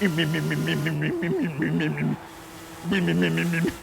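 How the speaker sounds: rapid stuttering motor hum; idling engine imitation. Retelling a glitchy car in a cutscene, imitated engine putter. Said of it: "it came idling up next to you".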